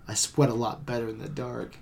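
low, soft, seductive purr; sultry femme fatale. Retold or imitated. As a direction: murmur it like a teasing film seductress. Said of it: "And Breathless Mahoney says".

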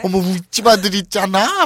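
eager middle-aged busybody voice; gossipy ajumma. Acted. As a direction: spill neighborhood scandal, hammed-up. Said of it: "막 완전 아줌마들 그거 있잖아요".

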